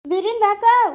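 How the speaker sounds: loud, high-pitched shout to hurry; urgent calling out. Acted. Urging someone to come quickly, calling out. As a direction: holler for them to come at once.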